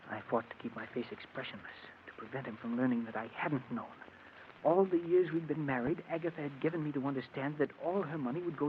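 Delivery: very soft, private narration; hushed inner monologue. Acted. Husband thinking to himself during questioning, hushed inner monologue. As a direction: confide the thought under your breath.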